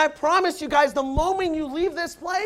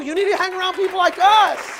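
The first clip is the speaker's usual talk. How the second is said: loud, higher call to the crowd; rousing call; 5 semitones higher, 7 dB louder than usual. Real, not acted. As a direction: push it out to the whole room. Loud rallying line.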